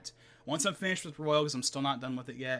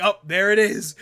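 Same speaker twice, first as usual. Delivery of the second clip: loud, raised exclamation; excited recognition; 4 semitones higher, 11 dB louder than usual. Acted. Viewer spotting the dramatic face in trailer, excited. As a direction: call it out as the reveal lands.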